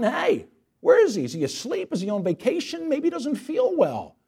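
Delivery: high, mocking taunts at rival prophets; trash talk. Elijah taunting the prophets of Baal, high mocking jeer. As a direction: jeer like a cocky heckler. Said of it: "Elijah starts talking trash".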